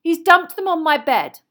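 loud, high aggrieved complaint; put-upon indignation; long-suffering. A narrator's reading. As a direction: tell on him, sorely tried. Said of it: "in a long-suffering voice".

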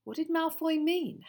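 usual speaking voice

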